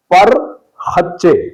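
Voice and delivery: پر خچے split slowly, "par-khach-che"; غلط تلفظ. Shown as a demonstration. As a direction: break پر خچے after the r, slowly. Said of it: "غلط تلفظ ہے". (پر خچے، رے ساکن کے ساتھ)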